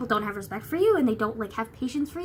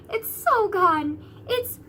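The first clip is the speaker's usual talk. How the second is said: slow, high, teary wail rising louder; near crying; 9 semitones higher, 5 dB louder, much slower than usual. Real, not acted. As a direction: let it break into a whiny cry. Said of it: "I'm so upset".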